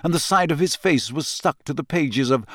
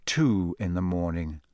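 softer, lower, tired; weary and soft; 8 semitones lower, 7 dB quieter than usual. A narrator's reading. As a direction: let it sink down tired. Narrated hours passing.

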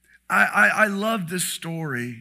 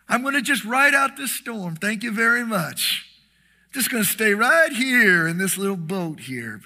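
mock complacent; 6 semitones higher, 7 dB louder than usual. Mocking boat-sitter Christians.

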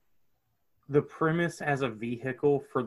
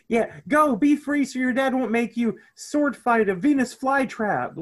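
Dean yelling; 9 semitones higher, 4 dB louder than usual. Retold or imitated. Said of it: "Hyper-specific stuff like".

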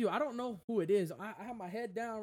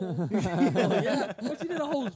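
laughing loudly through the words; real laughter, higher; 4 semitones higher, 10 dB louder, slower than usual. Real, not acted. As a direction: crack up mid-story. Laughing while telling story.

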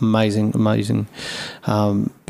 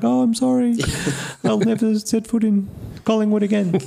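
much higher, hammed-up apology; mock despair; 12 semitones higher than usual. Brewer imagining his shamed exit, high. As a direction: wail it like a sad puppet. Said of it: "then I'd be like".

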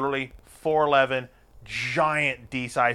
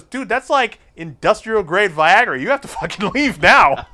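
urgent, amused warning; 7 semitones higher, 7 dB louder than usual. Retold or imitated. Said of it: "He goes".

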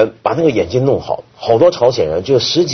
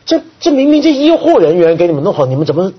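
high, louder stunned question; baffled disbelief; 7 semitones higher, 3 dB louder than usual. Retold or imitated. American reporters stunned by patients thanking the leaders, imitated disbelief. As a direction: sound dumbfounded, imitated. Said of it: "就，就，就傻住了".

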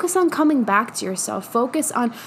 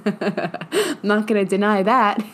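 slow words bubbling with laughter; laughing admission; slower than usual. Real, not acted. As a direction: laugh your way through the admission.

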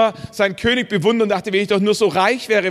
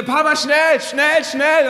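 urgent shout; 6 semitones higher, slower than usual. Retold or imitated. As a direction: yell for Papa as if something terrible happened. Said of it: "auf einmal ruft's wieder".

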